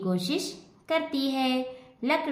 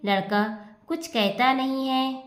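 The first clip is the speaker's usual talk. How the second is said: ordinary talk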